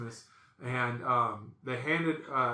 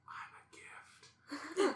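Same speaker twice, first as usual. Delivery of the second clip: tiny drawn-out whisper; playful whisper; 14 semitones higher, 9 dB quieter, much slower than usual. Retold or imitated. Man mimics relative announcing a gift, playful whisper. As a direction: whisper it very slowly, high and small.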